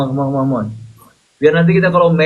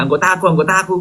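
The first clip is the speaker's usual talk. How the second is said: funny way of talking; 5 semitones higher, much faster than usual. Retold or imitated. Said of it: "Kocak banget ya cara ngomongnya".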